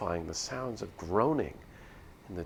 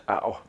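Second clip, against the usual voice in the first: pained laugh; 6 dB louder than usual. Acted. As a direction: laugh cut off by pain. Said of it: "I guess I can't laugh either".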